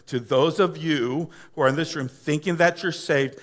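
ordinary talk, nothing marked

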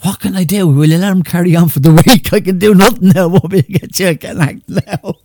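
loud, high imitation of a flustered promoter; Joe. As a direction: act out the harried promoter at full volume. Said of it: "Joe says to him".

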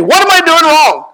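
high worried mock plea; mock anxious question. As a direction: play the nervous young leader asking what he did wrong. Mocking elders' nagging question.

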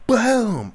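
a sharp, very high and loud "Boom!"; punch sound effect. Retold or imitated. Acting out knocking the rival out, loud punch noise. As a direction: bark out the hit like a sound effect.

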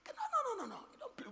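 high anguished cry of no, no; horrified no. Acted. Preacher crying out no to the congregation in horror. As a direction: wail it in mock horror.

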